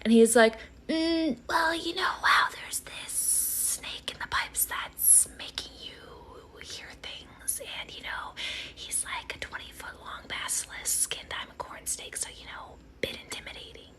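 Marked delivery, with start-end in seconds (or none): 0.9-2.5 high, soft, evasive little voice; weird-acting snake. Snake acting weird when Harry says hello, high and soft. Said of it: "acting all weird". 2.6-13.9 very faint, slow snake whisper; weird-acting snake. Nervous corn snake tells Harry about the basilisk, faint whisper. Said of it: "acting all weird".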